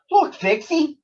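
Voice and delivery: put-on an old owl spotting her lost friend; relieved owl cry. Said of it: "cried the owl, relieved".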